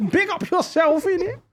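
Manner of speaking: very high London rapper impression. Launching into rapper impression for a friend, high squeaky street accent. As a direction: squeal it out in mimicked grime-MC accent. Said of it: "launch into fucking, uh, [name]".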